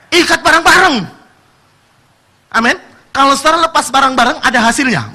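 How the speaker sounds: loud, rising preacher's call; preaching at full force. Acted. Preacher urging the congregation to bind together, loud. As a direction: drive it home to the church.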